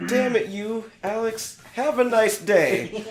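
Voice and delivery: high, loud hammed-up anger; mock polite rage. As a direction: yell a courteous insult, stretching the last words. Acting out polite friend raging.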